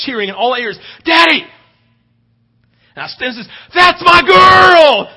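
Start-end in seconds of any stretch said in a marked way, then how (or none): 1.0-1.4 put-on high, loud call of a child's Daddy; imitated shout. Daughter calls from first base. 3.7-5.1 slow, loud and high, stretched out; proud shout. Retold or imitated. Dad shouting from the bleachers.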